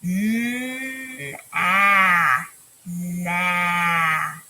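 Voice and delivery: teacher modelling a blend. Shown as a demonstration. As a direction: draw the sounds into one. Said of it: "I'll do it first".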